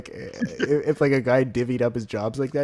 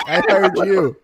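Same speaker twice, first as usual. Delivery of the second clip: indignant customer; 7 semitones higher, 9 dB louder, much slower than usual. Retold or imitated. Customer voiced, loud.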